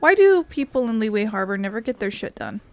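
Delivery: ordinary talk, nothing marked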